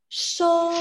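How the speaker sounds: "Sho" held high and level, first tone; Mandarin first tone. Shown as a demonstration. Teacher modelling a syllable for students, high level tone. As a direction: sing "Sho" out high and flat. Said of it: "Dibacanya gimana?".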